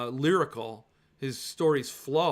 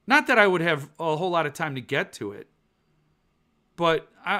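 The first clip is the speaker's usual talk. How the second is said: ordinary delivery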